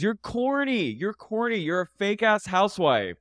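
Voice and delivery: high-pitched mock whine; mocking imitation. Mocking retold insults.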